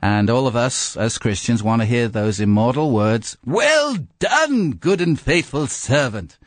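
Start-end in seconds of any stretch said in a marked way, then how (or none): 3.5-6.4 high, grand heavenly voice; mock-divine English pronouncement; accent of heaven. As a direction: announce it like a voice from heaven. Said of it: "This is the accent of heaven".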